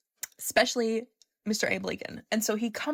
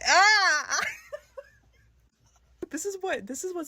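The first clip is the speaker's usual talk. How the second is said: excited squeal; 10 semitones higher than usual. Real, not acted. Everyone staring at them, she squeals with laughing delight. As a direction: shriek with delight then laugh.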